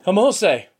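loud call across the courtyard, falling at the end. A narrator's reading. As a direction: shout her name to be heard over the crowd. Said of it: "he called out".

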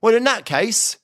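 high-pitched, obliging village policeman; beaming officer. A narrator's reading. Officer relents for the polite visitor, beaming. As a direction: give in happily, pitched well up. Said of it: "The officer beamed".

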